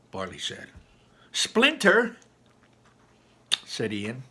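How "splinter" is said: whiny complaint. Acted. Complaining about splinter.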